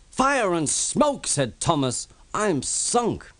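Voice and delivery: high, slow dismayed cry. Acted. Thomas sinking into the mine, high and dismayed. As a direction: gasp it out in shock.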